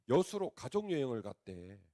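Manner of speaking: normal talking voice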